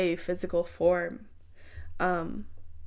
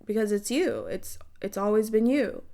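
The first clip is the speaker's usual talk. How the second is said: ordinary talk, nothing marked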